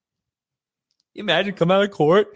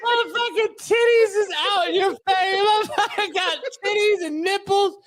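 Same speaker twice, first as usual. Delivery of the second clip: high voice; 10 semitones higher than usual. Acted. Acted amazed exclamation.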